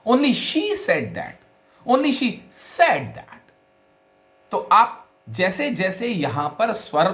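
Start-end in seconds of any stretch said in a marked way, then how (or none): 0.1-1.3 "she" punched high in a slow sentence; stress on SHE. Shown as a demonstration. Teacher moves the stress along the sentence, stress on "she". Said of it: "किसी भी शब्द के ऊपर stress को बदलते". 1.9-3.4 "said" set apart, high and drawn out; stress on SAID. Shown as a demonstration. Teacher moves the stress along the sentence, stress on "said". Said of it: "किसी भी शब्द के ऊपर स्ट्रेस को बदलते".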